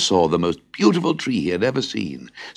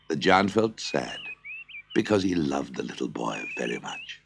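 usual speaking voice

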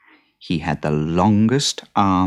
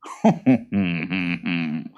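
odd creature chuckle; greedy boggart chuckle; boggart laugh. Acted. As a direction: cackle with glee.

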